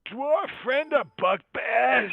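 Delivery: high, slow, loud goblin squawk. Acted. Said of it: "in goblin noise".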